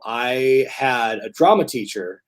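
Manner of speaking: usual speaking voice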